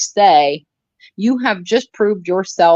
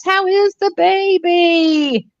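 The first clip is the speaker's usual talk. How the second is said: bright eager question; 10 semitones higher, slower than usual. Real, not acted. Echoing caller's warm question.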